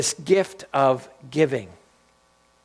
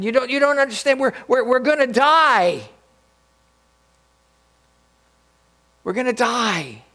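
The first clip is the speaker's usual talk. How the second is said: panicked plea; 7 semitones higher than usual. Retold or imitated. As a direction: desperately try to make him see. Panicked castaway pleads with friend.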